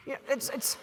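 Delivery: ordinary delivery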